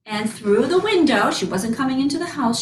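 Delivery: ordinary delivery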